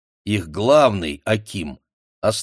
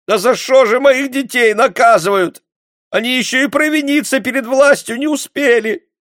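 high, loud pitiful whimpering plea; whining wail; 8 dB louder than usual. A narrator's reading. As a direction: whine for mercy. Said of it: "скулила женщина". (жалобно скулит)

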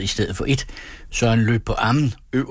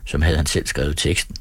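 usual speaking voice

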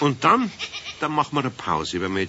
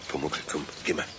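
much softer, hurried urging; urgent hush; 9 semitones lower, 9 dB quieter, faster than usual. Acted. Eder urging invisible Pumuckl away from keeper, whispered. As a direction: slip away quietly, come on.

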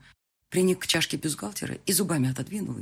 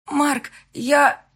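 hoarse answer; 11 semitones higher, 10 dB louder than usual. Acted. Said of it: "ответила я хрипло".

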